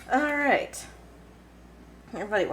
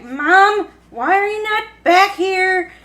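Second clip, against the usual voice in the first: put-on high, loud whiny plea as the fussy baby; mock child's plea; 9 semitones higher, 9 dB louder, slower than usual. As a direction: voice the baby calling for Mom, slowly. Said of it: "She's like".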